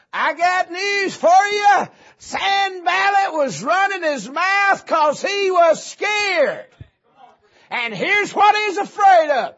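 high, loud, slow shouted sermon; full-force preaching. Real, not acted. Preacher driving home to congregation that Sanballat was scared, full-force preaching. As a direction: shout it out to the whole church, stretching the words.

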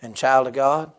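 usual speaking voice

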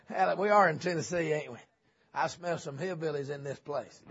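ordinary talk, nothing marked

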